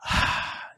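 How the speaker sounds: long, soft acted sigh; struggler's sigh. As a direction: breathe out like an overwhelmed beginner. Acting a struggling new entrepreneur.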